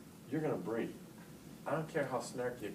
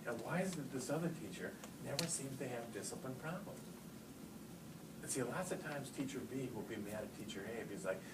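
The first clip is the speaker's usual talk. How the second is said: ordinary talk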